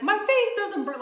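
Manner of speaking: high mock amazement; husband marvelling; mock amazed husband. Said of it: "And he's like".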